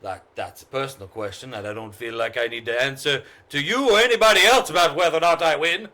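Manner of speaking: defensive outburst. Acted. Gambler snapping at a nosy stranger, loud and fast. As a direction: brush off the question in a hot, fast rant.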